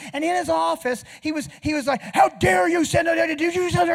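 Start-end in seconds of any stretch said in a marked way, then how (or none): 2.0-3.9 loud, high, spluttering tirade; acted shouted outburst; doctor's angry rant. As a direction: imitate a furious doctor yelling through the wall. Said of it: "and he's cussing".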